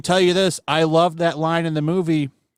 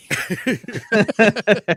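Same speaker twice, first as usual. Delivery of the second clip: high-pitched chuckle; amused laugh; 4 semitones higher than usual. Real, not acted. Cracking up over a quoted movie line, amused laugh. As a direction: laugh at your own retelling.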